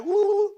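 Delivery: high-pitched woo-woo whoop; mock whooping call. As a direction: whoop briefly in a high falsetto. Mimics stereotyped war whoop.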